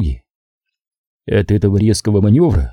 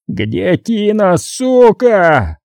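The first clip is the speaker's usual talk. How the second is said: furious yell; 9 semitones higher, much slower than usual. A narrator's reading. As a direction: bark it at her in rage. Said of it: "заорал он". (яростный крик)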